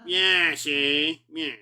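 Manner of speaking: put-on tough-guy gangster taunting the coppers, gangster accent; hammed-up old-movie gangster accent, punching each see; James Cagney accent. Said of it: "James Cagney accent".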